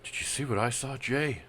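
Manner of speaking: shaky whisper. Acted. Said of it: "whisper in a shaky voice".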